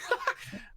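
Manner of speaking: short breathy burst of laughter; acted toady chuckle; sycophant's laugh. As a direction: laugh along at the sire's remark. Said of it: "and he's like".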